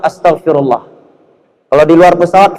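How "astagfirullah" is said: astagfirullah with a crisp Arabic f; Arabic accent. Shown as a demonstration.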